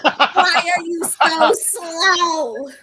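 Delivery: loud, high, very drawn-out jeer; heckling yell. Acted. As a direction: holler each word slowly at a distant ally. Criticizing Terrence across the battle.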